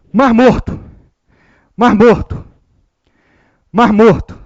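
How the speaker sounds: loud, explosive sneeze with the words burst out; sneezed out. Shown as a demonstration. Teacher fakes a sneeze for students, loud and explosive. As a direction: blurt it like a big sneeze. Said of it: "na hora de espirrar".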